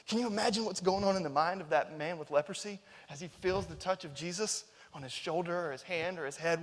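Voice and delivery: normal talking voice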